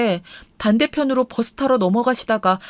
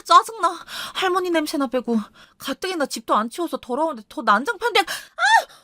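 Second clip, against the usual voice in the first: much higher, whining irritated wife; 5 semitones higher than usual. Retold or imitated. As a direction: complain in a shrill sulky whine. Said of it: "이따위 소리를 하더라고요".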